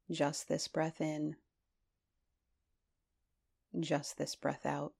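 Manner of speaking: calm voice leading a body scan; guided meditation. Acted. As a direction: ease them gently through the body.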